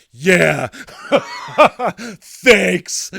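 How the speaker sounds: loud, laughing mock gratitude; angry sarcastic thanks. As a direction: spit out a big fake thanks. Acts out his curt angry reply.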